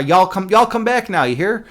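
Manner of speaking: playful go at a Texan accent. Shown as a demonstration. Streamer showing chat a Texan accent, playfully exaggerated. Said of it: "Texan accent".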